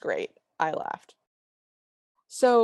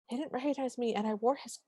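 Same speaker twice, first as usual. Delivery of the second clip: quiet high aside; 5 semitones higher, 7 dB quieter than usual. Retold or imitated. [name] retelling being unrecognized.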